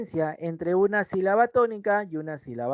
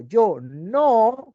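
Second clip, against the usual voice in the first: "Yo no" said louder, stressing "no"; emphatic "no"; 3 semitones higher, 5 dB louder than usual. Shown as a demonstration. Teacher showing capital-letter emphasis. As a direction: insist on "no". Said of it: "con mayúsculas".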